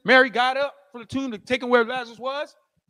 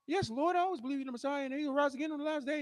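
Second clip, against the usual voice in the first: soft, higher, dutiful answer; meek reply; 5 semitones higher, 13 dB quieter, faster than usual. Acted. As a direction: answer quietly in a raised, hushed voice. Acting Mary's meek reply to Christ.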